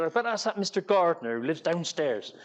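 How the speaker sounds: put-on mild Bristolian accent, blaming the neighbour; dad blaming Mister Gardner downstairs, light Bristolian accent. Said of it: "Bristolian accent".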